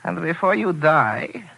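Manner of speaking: slow threat. Acted.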